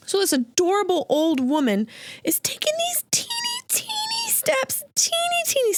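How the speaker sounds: put-on high squeaky voice for the teeny steps; tiny squeaky voice.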